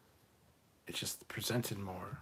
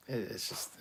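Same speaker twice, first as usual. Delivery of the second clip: usual speaking voice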